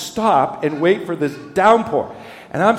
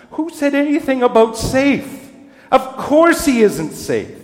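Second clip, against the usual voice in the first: higher and louder, slow on "Of course he isn't safe"; emphatic Mr Beaver; 10 semitones higher, 4 dB louder than usual. A narrator's reading. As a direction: insist like a gruff beaver. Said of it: "said Mister Beaver".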